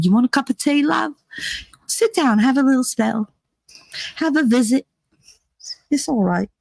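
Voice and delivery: high, hammy British granny voice offering tea; bad British accent. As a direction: lay on a clumsy English accent. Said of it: "Horrible English accent".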